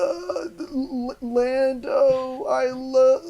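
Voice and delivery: high, halting dying-robot impression; hammed-up last words, straining and trailing off; mock death noises. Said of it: "making these death noises".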